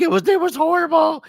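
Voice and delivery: high, loud wailing complaint; hammed-up tearful whine to mom; mock wail of a miserable beginner; crying retold. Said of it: "I'm crying".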